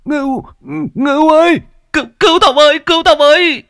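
very high, loud desperate cry for help; shrill loud screaming plea; terrified plea. Acted. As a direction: beg for rescue in panic. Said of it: "Ông Đại lắp bắp".

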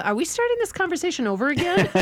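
high, fast, puzzled inner voice; baffled surprise. Retold or imitated. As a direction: play up your confusion at the reply. Baffled inner thought retold.

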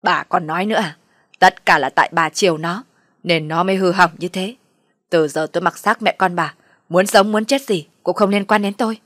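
loud, irate rebuke; husband angrily yelling at wife; angry shouting. Acted. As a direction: lash out and wash your hands of them. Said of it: "trợn mắt lên mà quát bà".

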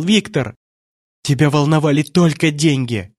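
high, rising reproach; acted anguished tirade; bitter accusation. A narrator's reading. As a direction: hurl reproaches in a high, distraught voice. Distraught mother blames her husband.

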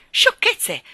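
loud, high, peeved exclamation; irritated. A narrator's reading. Miss Lark dismissing what the dog supposedly says, irritated. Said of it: "disse Miss Lark stizzita".

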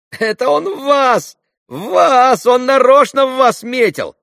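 put-on high, loud yelling of boys; jeering shouts. A narrator's reading. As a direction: shout it out like a gleeful gang of kids. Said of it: "закричали хохоча мальчики". (задорные крики мальчишек)

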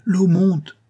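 high, loud urgent shout; alarmed shout. A narrator's reading. Maggie warning sleeping hosts of rising water, loud high shout. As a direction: shout the danger out. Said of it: "disait-elle".

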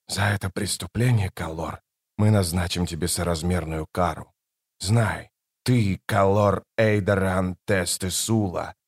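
grave judgement. Acted. Krul sentences Kallor to endless mortal life, low solemn doom.

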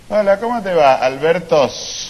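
mock friendly greeting. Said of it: "sus amigos le dicen".

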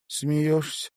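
usual speaking voice

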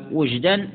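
وجدا with a short u, "wujdan"; u vowel on waw. Shown as a demonstration. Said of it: "مثلث الواو".

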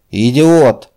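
furious bellow at a subordinate; roaring. A narrator's reading. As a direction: roar it out in fury. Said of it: "взревел полковник".

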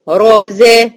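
"رزا" as dark "ro" then thin "zeh"; heavy ra, light zay. Shown as a demonstration. Teacher models contrast, "رزا" heavy then light. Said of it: "الر هنا مفخمة والزين مرققة".

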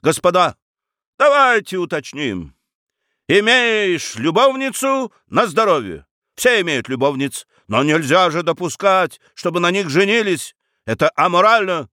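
high-pitched, emphatic argument; moralising. Acted. Lawyer lecturing the company on mistresses, moralising.

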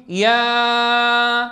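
يا with one very long, steady aa; stretched alif. Shown as a demonstration. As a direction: hold the vowel of يا out on a level tone. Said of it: "ولا صوت الألف مططته".

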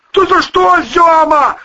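loud, very high bellow; roaring protest. A narrator's reading. Volyna backing away from the boss, roaring. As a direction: roar it out while backing away. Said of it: "заревел Волына".

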